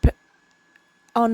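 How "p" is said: ordinary delivery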